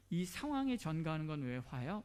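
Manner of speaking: normal talking voice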